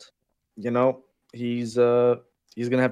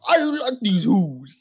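drawn-out, higher-pitched bad celebrity impression; awful Tracy Morgan; 9 semitones higher, 4 dB louder, slower than usual. Pitching Tracy Morgan as the Grinch, awful high impression. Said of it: "That was an awful impression".

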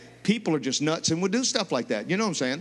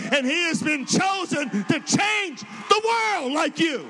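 preaching at full force; 7 semitones higher than usual. Acted. Preacher rousing the congregation, full-force preaching. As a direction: build it up and shout it to the crowd.

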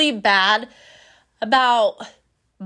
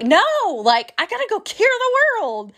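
eager retold self; 10 semitones higher than usual.

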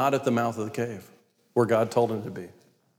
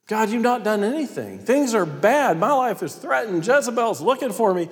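high, exasperated rant; Elijah; 8 semitones higher than usual. Acted. Prophet arguing with God from his cave, exasperated. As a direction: protest in disbelief, pitch raised throughout. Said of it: "it might have gone something like this".

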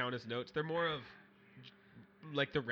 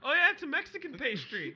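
imitating a girl; 10 semitones higher, 5 dB louder than usual. Voicing the girl in retelling.

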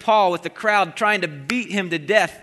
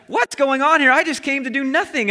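protesting innocence; 7 semitones higher, 3 dB louder than usual. Retold or imitated.

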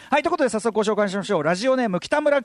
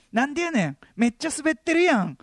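high-pitched fake Kansai retort; odd-intonation Kansai accent; 7 semitones higher than usual. Student heckling classmates in odd fake Kansai accent. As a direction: overdo a badly learned Kansai tsukkomi. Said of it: "変なイントネーションの関西弁".

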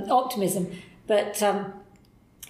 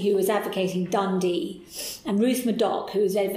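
ordinary delivery

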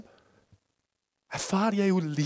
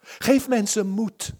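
hoger en luider smeken, langzaam; bijna schreeuwend; 5 semitones higher, slower than usual. Retold or imitated. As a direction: pleit dringend bij God. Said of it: "Ik begon er bijna te schreeuwen". (hoger, luider en langzaam smekend gebed)

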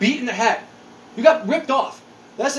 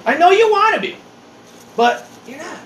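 higher, incredulous lecture to an aging guy; exasperated scolding; 9 semitones higher than usual. Retold or imitated. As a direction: tell him off in disbelief. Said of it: "I'm like".

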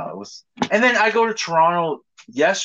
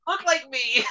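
very high, hammed-up dismay; mock horrified squeal. Friend plays a visitor inside the other's dream, high mock horror. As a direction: wail it high, playing at being appalled.

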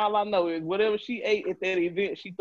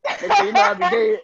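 squeaky high mock voice, loud; shrill-pitched teasing imitation; mock high squeal; 5 dB louder than usual. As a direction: squeal it like a cartoon. Mocking Justin's story.

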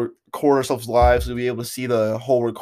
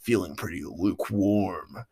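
slow, low and soft deep voice; 6 semitones lower, 7 dB quieter than usual. Pretend. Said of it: "I will speak slowly in a deeper voice".

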